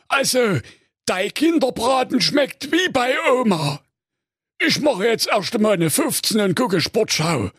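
high, contented cartoon ogre; full-bellied ogre, half sung. Ogre praises meal, orders wife.

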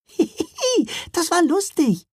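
high, breathless little elves giggling. A narrator's reading. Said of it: "riefen sie außer Atem".

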